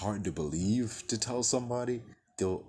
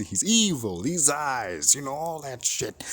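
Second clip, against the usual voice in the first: ordinary talk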